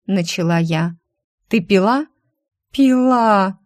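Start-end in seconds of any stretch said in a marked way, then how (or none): 1.5-2.1 stern questioning. A narrator's reading. Stern interrogation of hungover secretary. 2.7-3.6 loud, high, drawn-out wail; hammed-up howl of guilt; wailing confession. A narrator's reading. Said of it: "она сразу уронила голову на стол и взвыла".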